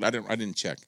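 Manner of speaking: ordinary delivery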